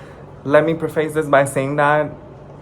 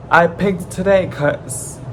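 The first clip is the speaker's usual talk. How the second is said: voice crack; 7 semitones higher, slower than usual. Real, not acted. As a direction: voice cracking up high. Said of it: "did you hear my voice crack?".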